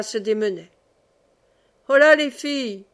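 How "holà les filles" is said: put-on higher, louder shout to the girls; called-out hail. A narrator's reading. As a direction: hail the girls across the field. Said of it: "cria le frère Bruno".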